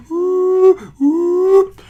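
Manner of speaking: high, loud two-tone whoop; weird whoop noise. Shown as a demonstration. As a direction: make a strange doubled-voice whoop.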